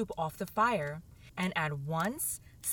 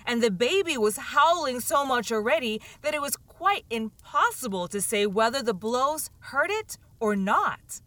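ordinary delivery, nothing marked

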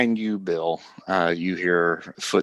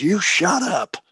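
furious shouting; 10 semitones higher, faster than usual. Acted. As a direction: rage at Hurley, spitting accusations. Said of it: "Cornelia says".

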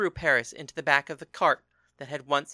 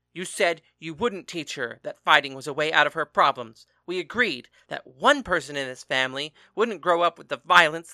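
loud, raised furious tirade; angry shouting; 8 dB louder than usual. A narrator's reading. Father shouting at husband about training their daughter, angry. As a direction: hammer each accusation home.

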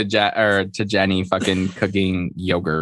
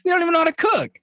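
very high, squeaky scoff; mock a snob dismissing her guests; high, sneering put-down; snooty mock quote; much faster than usual. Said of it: "She's like".

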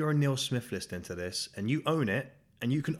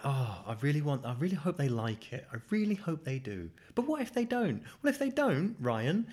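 worried self-talk that jumps much higher on the what-ifs; anxious inner voice; 7 semitones higher than usual. Retold or imitated. Podcaster voicing his own nervous thoughts, climbing to a high anxious squeak. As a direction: spiral into panic at "what if they don't". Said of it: "and I'm like".

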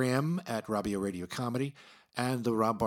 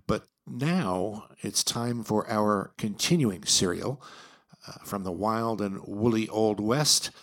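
slow, loud serial announcer; radio announcer; 5 dB louder, slower than usual. Acted. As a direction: announce the serial grandly, slowly.

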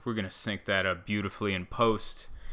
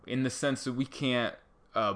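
ordinary talk

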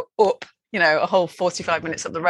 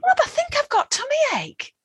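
much higher, small understated voice; innocent complaint. Retold or imitated. Mother in labour telling husband Rob, in a small high voice. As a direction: play down labour pains as a tummy ache. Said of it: "how I was going".

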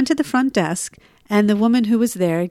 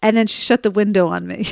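normal talking voice

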